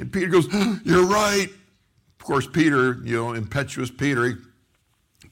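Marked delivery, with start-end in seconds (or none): 0.5-1.5 acted gasp, then high-pitched amazement; retold astonishment, pitched up; sudden realization. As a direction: gasp and blurt it as it dawns on you.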